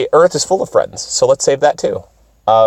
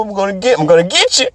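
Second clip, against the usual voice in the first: mock threat; 7 semitones higher than usual. Mock threat at own tongue.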